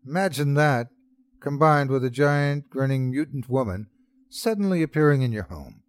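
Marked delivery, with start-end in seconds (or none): none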